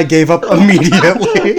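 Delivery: fast, giggling through the words; laughing. Real, not acted. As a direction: laugh at yourself. Laughing at own failed accent.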